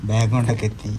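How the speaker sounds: normal talking voice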